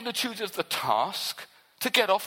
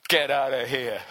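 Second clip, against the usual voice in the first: mock disciples' brush-off; 6 semitones lower, 3 dB louder than usual. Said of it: "your response will be right now like the disciples".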